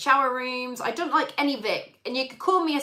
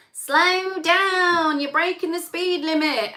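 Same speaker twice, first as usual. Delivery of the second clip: nagging passenger voice; 6 semitones higher, slower than usual. Retold or imitated. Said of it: "somebody saying".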